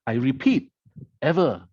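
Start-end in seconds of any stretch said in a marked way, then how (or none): 1.2-1.7 Ever said alone, loud and falling; emphatic ever. Retold or imitated. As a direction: hit Ever hard, like a final warning. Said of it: "the emphasis there".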